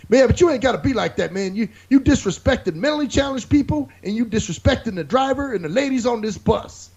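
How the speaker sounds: high-pitched imitation of an indignant scolder; hammed-up righteous objection; mock social justice warrior. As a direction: scold him in a put-on high voice. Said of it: "got all social justice warrior on me".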